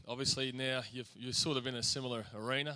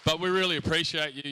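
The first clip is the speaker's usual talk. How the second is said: loud, raised emcee call to the crowd; MC announcement; 5 semitones higher, 7 dB louder than usual. Acted. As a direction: get the hall clapping. MC rallying the school audience.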